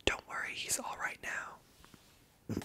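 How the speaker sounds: normal talking voice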